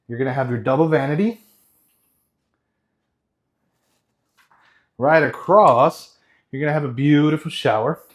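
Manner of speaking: out of breath from running around; talking between heavy breaths. Real, not acted. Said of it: "if you hear me breathing hard".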